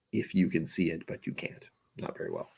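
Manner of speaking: a sly little whispered joke; whispered aside. Real, not acted. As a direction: whisper it under your breath. Joking aside about a blurry picture.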